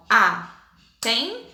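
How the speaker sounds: atenção slowly, one syllable per clap; split into syllables. Shown as a demonstration. Teacher has pupils clap syllables, atenção in three slow beats. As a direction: say atenção as a, ten, ção, pausing between. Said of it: "vai separar em três sílabas".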